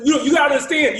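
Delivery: high, loud stammered plea; desperate insistence. Retold or imitated. Student interrupted by host keeps begging, desperate insistence. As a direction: burst back in, begging to be heard.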